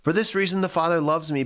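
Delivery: normal talking voice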